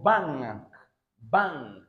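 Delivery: "bang" with a velar ng before a light final k; "bang" spoken as a single-word model. Shown as a demonstration. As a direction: model "bang" as bank, through the ng. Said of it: "I told you it is ng sound".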